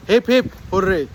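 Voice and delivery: loud, joyful cheer. Real, not acted. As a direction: hip hip hooray at the top. Cheering at reaching the summit.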